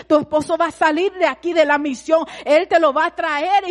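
loud stern pastor laying down the law. Retold or imitated. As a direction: lecture her firmly and loudly, like her pastor. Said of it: "Y él me decía".

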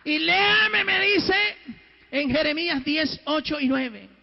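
alto y fuerte, retando; lánzale el reto a viva voz; exigencia a gritos. Retold or imitated. Católico exige otro pasaje. (exigencia gritada del católico al padre)

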